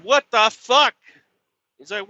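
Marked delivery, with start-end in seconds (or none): 0.0-0.9 loud, high What the fuck; a loud shocked outburst at the boss; retold outrage. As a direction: blurt it out in shocked anger. Reacting to boss news.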